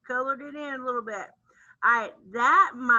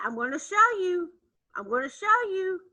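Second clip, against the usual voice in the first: teasing; 7 semitones higher than usual. Acted. Building suspense for kids.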